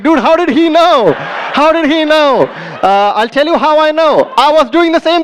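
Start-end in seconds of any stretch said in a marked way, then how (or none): 0.0-2.4 stunned dude asking how he knew; loud, higher amazed whisper-turned-shout. Retold or imitated. Audience member amazed at the guess, loud and high.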